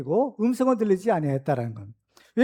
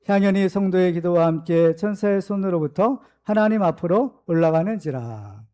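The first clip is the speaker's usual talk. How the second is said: lower, measured reading aloud; scripture reading; 4 semitones lower than usual. Acted. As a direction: lead the congregation steadily through the passage.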